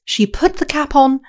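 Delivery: plain reading voice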